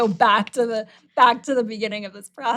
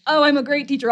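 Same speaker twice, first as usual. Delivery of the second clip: mock proud; 5 semitones higher, 6 dB louder, faster than usual. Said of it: "stuck in this trap of".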